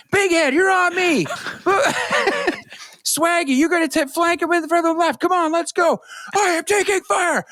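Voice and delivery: high shouted commands, laughing through; gung-ho soldier. Retold or imitated. Streamer commands random squadmates in lobby, high mock soldier. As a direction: play the drill-sergeant squad leader. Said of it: "sounds like Gunny off of Full Metal Jacket".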